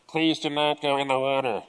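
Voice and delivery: imitating a lifeguard on a loudspeaker; droning mock lifeguard announcement. Lifeguard warns swimmers off the water, mock announcement voice. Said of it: "the lifeguard's on the scene".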